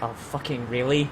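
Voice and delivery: low, muted exasperation, imitating the actor; mock weary disbelief, held down under the breath; suppressed eye-roll. Imitating an actor stuck with a bad line, suppressed eye-roll. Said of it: "trying his hardest not to roll his eyes".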